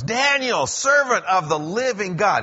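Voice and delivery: high, loud, anguished cry of a name; a frantic king calling down into the den, anguished. A narrator's reading. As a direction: call out desperately to someone trapped below. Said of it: "called to Daniel in an anguished voice".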